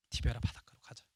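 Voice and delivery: hushed secret whisper. Retold or imitated. Peter quietly urging the disciples back to the sea, whispered. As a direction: whisper it like a guilty plan.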